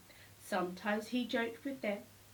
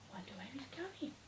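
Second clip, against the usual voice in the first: breathy unvoiced whisper, much softer; whispered aside; 3 semitones higher, 13 dB quieter, faster than usual. A narrator's reading. Narrator whispers what the sleepy elephants wonder. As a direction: whisper it like a secret.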